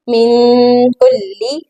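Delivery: "मिन" held in a hum before "कुल्ली"; ghunna. Shown as a demonstration. Teacher joining the words, slow "मिन" with ghunna. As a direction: linger nasally on the n of "मिन". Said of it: "यहाँ पर हमने गुना करना है".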